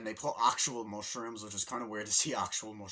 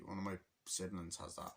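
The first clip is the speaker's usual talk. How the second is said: ordinary talk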